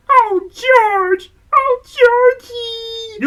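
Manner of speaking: Mary melting with relief and tears; Mary's tearful joy. Acted. Mary embracing George again, high tearful relief.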